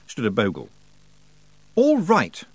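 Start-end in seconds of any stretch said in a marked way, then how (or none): 1.7-2.4 put-on high, firm young hero voice; tight, determined character line; grim resolve. A narrator's reading. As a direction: steel yourself, pitched up. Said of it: "said Roland grimly".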